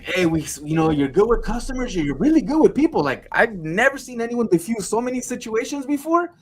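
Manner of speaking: high-pitched mock workplace talking-to; high, animated imitation of management; imitated exasperated boss. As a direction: praise him, then let him down. Imitating bosses assessing his sales.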